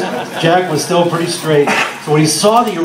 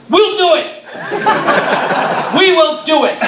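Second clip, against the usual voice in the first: much higher, bursting with excitement; excited shout; 12 semitones higher than usual. Retold or imitated. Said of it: "burst into the dressing room and went".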